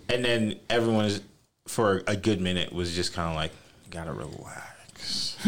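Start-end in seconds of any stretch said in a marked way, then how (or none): 3.9-5.4 slow, low whisper; imitating a whispered caution; hushed reaction. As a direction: whisper it slowly as a retold aside. Retelling how others reacted to jokes.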